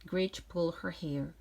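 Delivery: ordinary delivery, nothing marked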